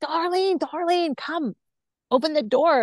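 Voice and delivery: high, insistent calling through a door. Retold or imitated. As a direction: call her name through the door, pleading. Man calling through locked door.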